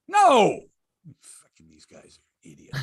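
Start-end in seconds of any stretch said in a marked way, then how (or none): 1.2-2.8 much softer, disgusted mutter. Real, not acted. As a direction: grumble it under your breath.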